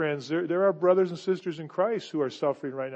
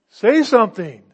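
loud urging; 2 semitones higher, 12 dB louder than usual. Real, not acted. Preacher urging the congregation.

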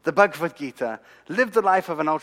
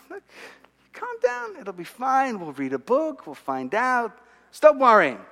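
placating wife; 7 semitones higher than usual. Retold or imitated. Wife tells dramatic husband not to worry, placating. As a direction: imitate a wife talking him down.

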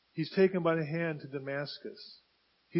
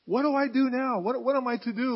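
raised, higher-pitched acted pleading; desperate plea; 5 semitones higher, 4 dB louder than usual. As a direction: plead loudly, at a loss what to do. Saul acted, at a loss before God.

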